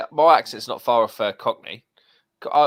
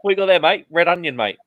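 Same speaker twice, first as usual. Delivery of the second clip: mock onion party bloke; 5 semitones higher, 6 dB louder than usual.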